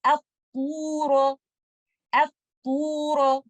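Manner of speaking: At-turu with a heavy, back-of-tongue ta, said slowly; heavy recitation. Shown as a demonstration. Teacher recites At-turu twice for students, slowly. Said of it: "We will recite it like this".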